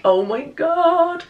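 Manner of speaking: high, slow delighted gush; gushing over a gift. Real, not acted. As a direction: draw it out with real delight. Delighted by pink socks.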